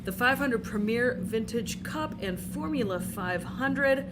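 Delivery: usual speaking voice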